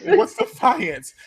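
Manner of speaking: slow, very high-pitched question; hammed-up clueless imitation; mock cluelessness. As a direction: exaggerate the naive question, drawn out high. Mocks Marcus's clueless question.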